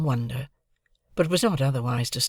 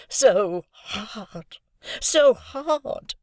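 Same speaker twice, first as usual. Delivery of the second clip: moaning; 8 semitones higher, much slower than usual. A narrator's reading. Havisham moaning at Estella's hardness. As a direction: wail it higher on the repeat. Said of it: "moaned Miss Havisham".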